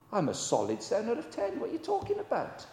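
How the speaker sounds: high, squeaky put-on protest; mock indignation. Preacher voicing a listener protesting it's unfair, mock indignant. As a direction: play the offended churchgoer.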